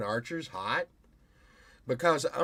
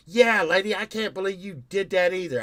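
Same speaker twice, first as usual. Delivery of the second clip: mock rude stranger; 6 semitones higher, 6 dB louder than usual. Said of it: "some guy in the line goes".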